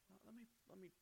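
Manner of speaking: tiny, tentative voice; acted self-restraint. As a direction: back off quietly, holding the words in. Voices someone holding their tongue.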